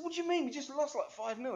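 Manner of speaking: mock-incredulous, high and loud; baffled protest. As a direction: retell a disbelieving objection, pitched right up. Retells his baffled reply to mate.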